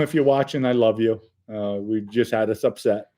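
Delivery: usual speaking voice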